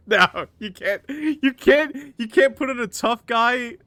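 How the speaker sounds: high, loud amused disbelief; real incredulous outburst through laughter; laughing protest. Real, not acted. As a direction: protest while cracking up. Laughs at the silly tough guy.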